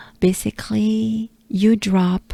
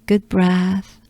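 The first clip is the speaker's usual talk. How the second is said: ordinary talk, nothing marked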